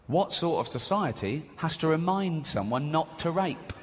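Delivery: usual speaking voice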